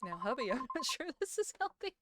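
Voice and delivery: giggling through words; laughing. Real, not acted. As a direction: tease while laughing. Teasing line, laughing.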